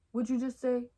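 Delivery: intimidating. Retold or imitated. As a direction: confront them loudly, as in a retelling. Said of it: "All intimidating".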